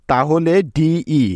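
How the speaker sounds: drawn-out letters ডিই sounded one by one; teacher spelling ডিই slowly. Shown as a demonstration. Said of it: "মিলে হলো ডে".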